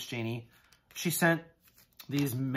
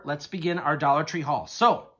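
normal talking voice

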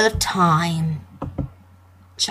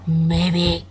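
normal talking voice

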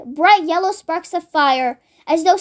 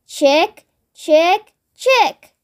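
coaxing chicken call; much slower than usual. Acted. George offering a spoonful to a chicken, coaxing call. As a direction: call a chicken over, word by word.

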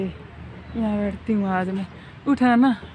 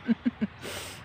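breathy laugh; 3 semitones higher, 6 dB quieter than usual. Real, not acted. Laughs after voicing son.

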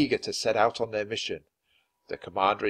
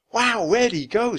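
put-on excited girl; 12 semitones higher, 5 dB louder than usual. A narrator's reading. Annie excited, looking around.

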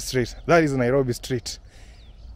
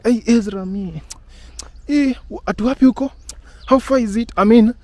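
high, fretful imitation of worried clients; half-teasing nervous whine; mock nervous; 9 semitones higher, 4 dB louder than usual. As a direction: play the anxious client, pitch up and fret. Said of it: "and they were nervous".

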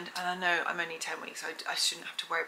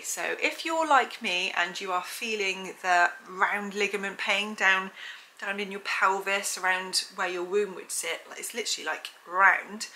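ordinary talk, nothing marked

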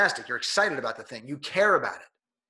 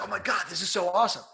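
mock delighted audience; 6 semitones higher, faster than usual. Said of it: "everybody else is like".

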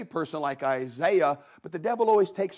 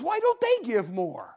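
high, whining church member; whiny complainer; 10 semitones higher than usual. Retold or imitated. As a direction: whine that nobody else gives. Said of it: "somebody else says".

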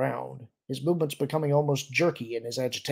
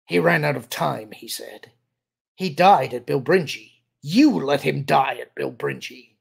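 louder, heated blame; bitter accusation; 2 semitones higher, 7 dB louder than usual. A narrator's reading. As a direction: throw the blame at them forcefully. Cornered officer accuses his superior.

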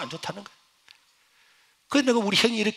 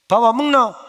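louder, higher call to eat; mother urging a fasting son to eat; 6 semitones higher, 9 dB louder than usual. Retold or imitated. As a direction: press him to come eat.

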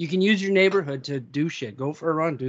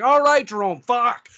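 higher, louder acted exasperation; exasperated outburst; 5 semitones higher, 5 dB louder, slower than usual. [name] snapping back at the TV ad, retold exasperation. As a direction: give in loudly, imitating someone fed up.

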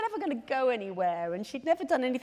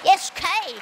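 Kay; 8 semitones higher, 8 dB louder than usual. Acted. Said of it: "Kay is in the show".

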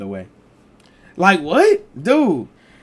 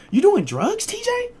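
suspicious mom; slower than usual. Retold or imitated. Mom asking him.

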